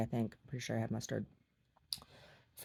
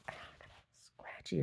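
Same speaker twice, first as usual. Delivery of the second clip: unvoiced, much softer complaint; whisper; 13 dB quieter than usual. Real, not acted. Complaining to herself about a scratch, whispered. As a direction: whisper it as a private aside.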